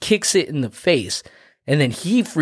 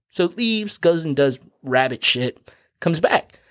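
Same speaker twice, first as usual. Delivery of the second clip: ordinary talk, nothing marked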